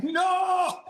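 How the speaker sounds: long, high mock-horrified yell; drawn-out comic "no" of protest; retold cry of horror. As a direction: wail it in comic dismay. Retold horror at punchline photo.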